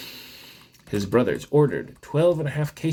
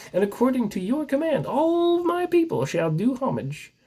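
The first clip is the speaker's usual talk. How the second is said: grand proclamation; 12 semitones higher than usual. Acted. Emperor proclaims Klee's power.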